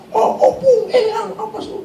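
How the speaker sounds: very high, mock yelling without real volume; imitated possessed cries. Possessed youth crying out to ancestor spirits, imitated high cries. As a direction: squeal it like someone possessed. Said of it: "sudah teriak-teriak".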